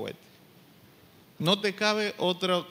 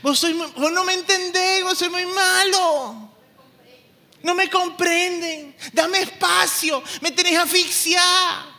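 put-on much higher, louder whiny teen voice; mocking sulky teenager; 11 semitones higher, 7 dB louder than usual. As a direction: parody the fed-up teenager talking back. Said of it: "¿Qué es lo que va a decir el joven?".

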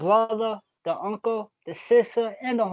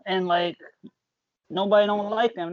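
ordinary talk, nothing marked

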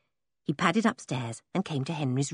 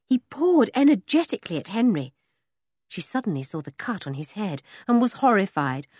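ordinary delivery, nothing marked

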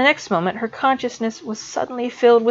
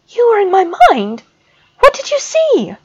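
put-on much higher, louder panicked girl; voice rising high; 12 semitones higher, 6 dB louder than usual. A narrator's reading. Student panics at the professor, voice shooting high. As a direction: let the voice jump up in alarm. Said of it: "her voice rising automatically toward her natural octave".

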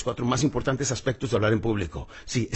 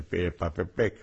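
pen in mouth; 7 semitones lower, 5 dB quieter than usual. Shown as a demonstration. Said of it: "Te pones la pluma en la boca y empiezas".

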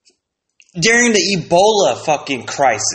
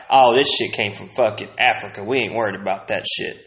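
mock dismissive; 7 semitones lower, 4 dB quieter than usual. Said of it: "Y'all were like".

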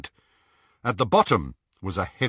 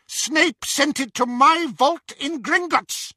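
high, slow witch voice; proud mad witch; Bellatrix voice; 4 dB louder than usual. A narrator's reading.

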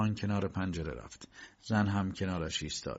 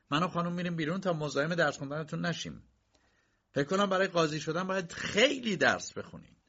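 spiteful mockery; 10 semitones higher than usual. A narrator's reading. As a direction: needle the student with fake politeness. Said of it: "کی با حرص خندید".

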